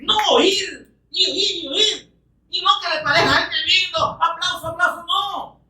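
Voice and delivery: high, fervent sermon voice; full-force preaching. Acted. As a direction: preach it out, lifted high and urgent.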